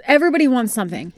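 normal talking voice

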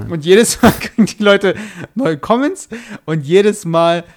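slow amused mockery, laughing. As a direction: drag it out with a laugh. Mocking likes, laughing.

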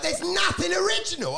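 high-pitched comic exasperation; exasperated complaint. Retold or imitated. Comic complaining about repeated gala acts, exasperated. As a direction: complain in disbelief, voice jumping up.

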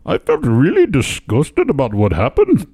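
slow mock Indian accent; a light put-on Indian accent. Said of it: "He sounds just like his dad".

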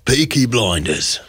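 slow Birmingham gangster impression. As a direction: stretch it out in a thick Brummie accent. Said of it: "Arthur Shelby doing Blur Song Two".

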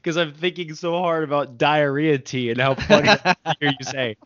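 amused, talking through laughter; laughing so much. Real, not acted. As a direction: struggle to get the question out for laughing. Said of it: "laughing so much".

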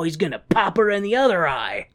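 ordinary delivery

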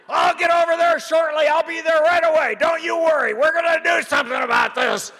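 high, far imitation of an excited old man yelling; Bernie on the phone. Bernie bursts in yelling into his phone. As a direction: play him shouting into a phone, disbelieving.

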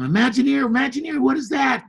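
put-on high, loud eager child's shout; excited yell. A boy yelling to his mom in excitement, high and loud. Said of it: "And I yelled at my mom".

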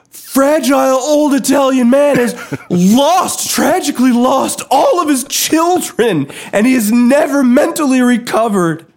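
hammed-up scandalised host telling a tale; mock TV host. Mock host condemns the podcast.

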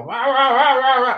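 high silly balloon-man noises; muffled wah wah talk. Retold or imitated. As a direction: play the goofy balloon guy squawking. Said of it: "and he'd go".

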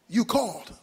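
a hint of a calm heavenly voice. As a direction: answer calmly as God, a little low. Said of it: "And a voice came out of heaven".

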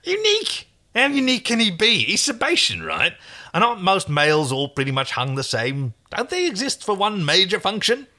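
high-pitched, scoffing market trader; scoffing stallkeeper. Acted. Stallkeeper doubts any male is unique, scoffing.

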